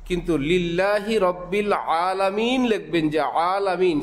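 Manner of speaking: ordinary delivery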